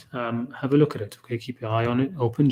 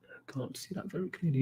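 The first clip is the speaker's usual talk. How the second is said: breathy whisper, much softer; whispered aside; 6 semitones higher, 11 dB quieter than usual. Real, not acted. Teacher squints at the text, whispering to himself. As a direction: drop to a whisper under your breath.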